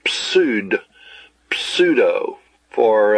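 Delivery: "pseudo" breathed out, unvoiced and slow; whispered pseudo. Shown as a demonstration. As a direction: hiss "pseudo" slowly with no voice. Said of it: "We say".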